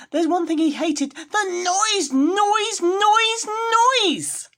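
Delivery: high, loud, strained squeal on each noise; piercing wail of irritation; shrieking disgust. Acted. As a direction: screech it like he can't bear it. Grinch hating the noise.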